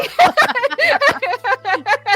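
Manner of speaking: high loud laugh; real laugh. Real, not acted. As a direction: burst out laughing. Players cracking up at table.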